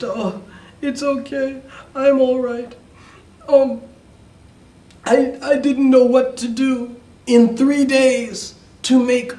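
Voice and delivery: high-pitched weepy daughter voice; acted tearful. A narrator's reading. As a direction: play the crying girl making excuses. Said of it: "And so she cried".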